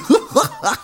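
high, mocking little laugh; high sneering laugh; smug giggle. A narrator's reading. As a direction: giggle at the watchman. Villain laughs at Mumm.

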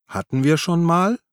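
high, tipsy come-on; flirty cooing. A narrator's reading. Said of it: "säuselte sie ihn an". (säuselnd, beschwipst)

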